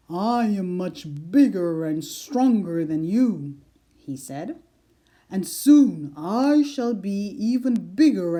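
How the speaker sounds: a big cat bragging; proud lion. A narrator's reading. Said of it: "The lion laughed out loud".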